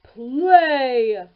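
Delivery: loud ogre roar. A narrator's reading. Ogre commands the golden harp, loud roar. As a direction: bark the order like a giant. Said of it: "roared the ogre".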